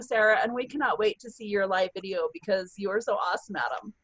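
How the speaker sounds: ordinary talk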